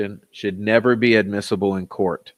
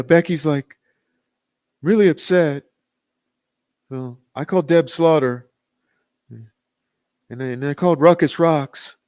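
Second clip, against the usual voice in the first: higher, louder mock voice of a rival streamer; mocking impression of a rival; 4 semitones higher, 3 dB louder than usual. Mocking a rival YouTuber's bragging, in a higher, louder mock voice. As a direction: ham up a rival's voice to mock him. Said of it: "I'm not necessarily an impressionist".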